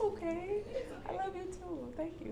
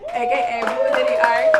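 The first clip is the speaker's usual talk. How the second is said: announcer shout, much higher and louder; hype announcement; 14 dB louder than usual. Acted. Host hyping her cousin to the crowd, shouting.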